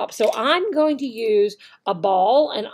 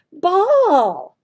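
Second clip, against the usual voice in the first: loud, higher single word; teaching call; 6 semitones higher, 6 dB louder than usual. Shown as a demonstration. Teacher modelling a new word loudly for students. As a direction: present the word boldly to the class. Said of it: "So you would do ball".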